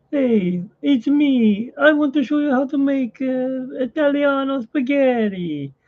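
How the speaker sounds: high, hammed-up Italian accent; Italian chef accent. Mocking the copycat chef, fake Italian accent. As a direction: play a cheesy Italian TV chef. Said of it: "why does he sound like Chef Capalbo".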